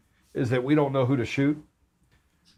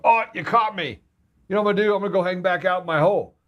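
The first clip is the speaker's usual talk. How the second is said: higher-pitched, lightly mocking voice for the devil; devil caught out; 6 semitones higher, faster than usual. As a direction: play a cornered devil, hammed up a little. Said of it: "What's that going".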